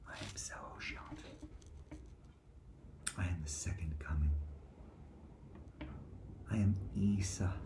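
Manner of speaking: hushed unvoiced whisper. Real, not acted. Speaker whispering claims to the audience, in a hushed whisper. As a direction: whisper it like a secret revelation.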